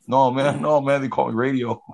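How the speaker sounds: high, loud, panicky denial; scared denial. Retold or imitated. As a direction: hurriedly insist you're someone else, loud. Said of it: "he's like".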